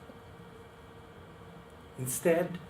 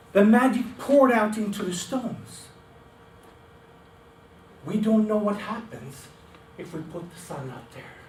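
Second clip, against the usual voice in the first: worried warning; 4 semitones higher, 7 dB louder than usual. Acted. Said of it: "he said".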